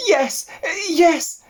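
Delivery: high eager little pig; piglet voice. Acted. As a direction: ask it like a keen young piglet.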